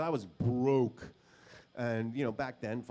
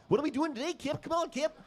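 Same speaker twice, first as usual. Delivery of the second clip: much higher, eager tagalong voice; eager puppy; faster than usual. Retold or imitated. Following Kip around, eager puppy voice. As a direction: beg for attention like a little dog. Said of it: "like a little dog".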